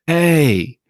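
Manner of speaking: sudden high call of his name; calling out to wake her husband; full voice. Retold or imitated. Wife waking husband, high full-voiced call. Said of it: "in full voice".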